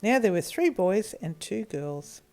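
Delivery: ordinary delivery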